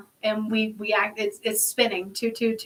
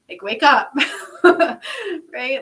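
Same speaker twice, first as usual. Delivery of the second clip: louder, laughing wake-up call; 4 semitones higher, 7 dB louder, much slower than usual. Real, not acted. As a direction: laugh it out while urging them. Urging listeners, laughing loudly.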